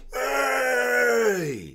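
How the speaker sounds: long, high, loud holler imitating workmen; shouted cheer. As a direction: let out a roaring mate's cheer.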